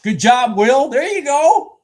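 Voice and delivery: high, loud congratulations; shouting praise at a winner; loud cheering. Real, not acted. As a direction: cheer them on like a fan. Cheering chat member loudly.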